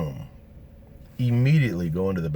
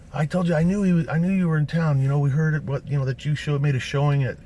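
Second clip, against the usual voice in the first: higher, louder excited chatter; excited fan; 8 semitones higher, 4 dB louder than usual. Retold or imitated. Fan on the sidewalk babbling excitedly, higher and louder. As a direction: babble at him like a thrilled fan. Excited fans surround him.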